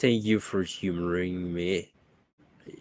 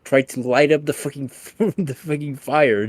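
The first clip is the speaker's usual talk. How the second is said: loud, laughing through it; laughing answer; 5 semitones higher, 6 dB louder than usual. Retold or imitated. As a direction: explain while cracking up. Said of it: "He's like".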